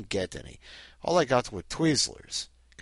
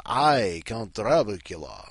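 slow, grand Transylvanian accent on "I, Count Dracula"; slow Transylvanian count introducing himself; slower than usual. Shown as a demonstration. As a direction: announce yourself in a heavy Transylvanian accent. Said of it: "saying with my accent".

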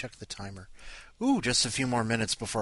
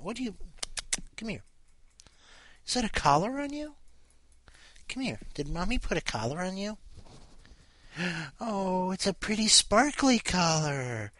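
cooing pet talk; 8 semitones higher than usual. Real, not acted. Owner admiring the cat's new collar, high baby talk.

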